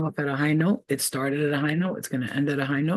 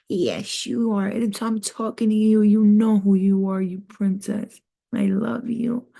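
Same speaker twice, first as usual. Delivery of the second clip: cooing; 5 semitones higher, 4 dB louder, slower than usual. Real, not acted. Cooing to pet/listener.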